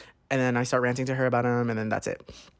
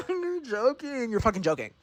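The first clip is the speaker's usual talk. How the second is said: incredulous; 9 semitones higher, 7 dB quieter than usual. Retold or imitated. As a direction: squeak it in disbelief, then come down. Disbelief after the shrieked what.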